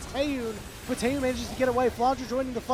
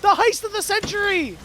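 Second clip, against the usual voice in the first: excited call; 11 semitones higher, 7 dB louder than usual. Real, not acted. Calling an outrageous base steal.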